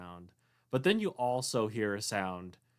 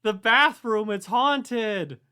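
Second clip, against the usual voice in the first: scream; 11 semitones higher, 10 dB louder, slower than usual. Acted. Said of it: "someone says".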